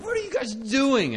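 high-pitched retold scolding; alarmed yell. Caretaker catching kids dangling out the window, alarmed yell. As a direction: re-enact yelping at kids in danger. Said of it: "I yell at them".

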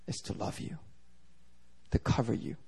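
very soft, hushed and low; tender reassurance. Retold or imitated. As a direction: let the words sink gently to comfort her. Pastor tenderly reassuring her.

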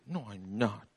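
lightly voiced daughter refusing; a hint of a stubborn girl. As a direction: say it like a stubborn child.

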